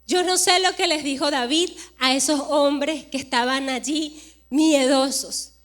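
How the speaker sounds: usual speaking voice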